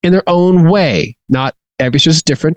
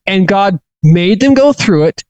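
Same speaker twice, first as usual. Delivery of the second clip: ordinary talk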